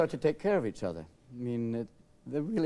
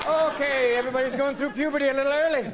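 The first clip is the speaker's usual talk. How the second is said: high, loud flustered cry; mock panic; 11 semitones higher, 5 dB louder than usual. Dad realizing he put on the wrong tape, loud and high. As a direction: scramble to cover the mistake.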